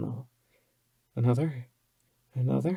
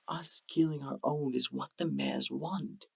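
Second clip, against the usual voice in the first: usual speaking voice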